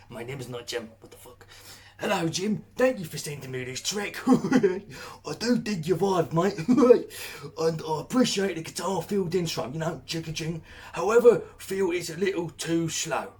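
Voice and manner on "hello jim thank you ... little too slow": loud, higher, laddish British voice; hammed-up British accent. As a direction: sell it like a cheeky London bloke. British reviewer voiced, "mate.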